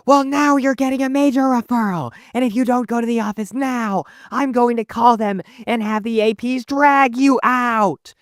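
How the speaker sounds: very high, strained mock teacher tirade; desperate angry teacher. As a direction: imitate a cornered teacher threatening a student. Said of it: "it makes them desperate".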